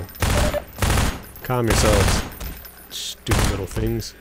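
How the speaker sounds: normal talking voice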